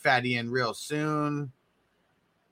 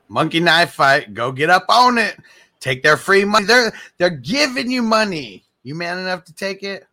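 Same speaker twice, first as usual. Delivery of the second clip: hyped sponsor plug; 4 semitones higher, 7 dB louder than usual. Acted. Hyping the sponsor after the ad.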